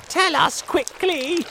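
very high, loud demand; urgent cry. A narrator's reading. Earthworm demanding James's idea, urgent cry. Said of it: "cried the earthworm".

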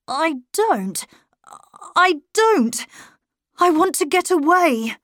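put-on a boy begging to get away, voice rising; pleading boy. A narrator's reading. Boy tells governess he wants away, pleading.

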